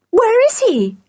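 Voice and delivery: very high, eager question; joyful cry. A narrator's reading. George eager to find the dog, crying joyfully. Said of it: "cried George joyfully".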